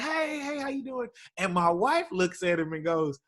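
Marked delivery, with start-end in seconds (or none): 0.0-1.1 high, soft friendly greeting; lightly voiced passing churchgoers; imitating churchgoers. Churchgoers greeting Uncle Earl after offering, high and soft.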